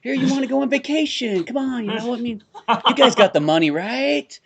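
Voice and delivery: high, slick hammed-up pitch; sleazy pitch to a mark; lightly voiced sleazy salesman; shyster salesman. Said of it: "shyster-looking guy".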